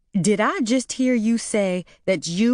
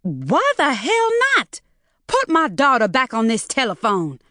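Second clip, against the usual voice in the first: shout; 6 semitones higher than usual. A narrator's reading. Mother shouts for her daughter on the phone. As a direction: demand it, shouting. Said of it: "Maureen heard a voice shout".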